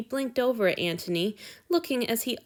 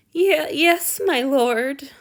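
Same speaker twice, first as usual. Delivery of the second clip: high, loud and wretched; 6 semitones higher, 6 dB louder, much slower than usual. A narrator's reading. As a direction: say it in misery. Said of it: "Han Yu said wretchedly".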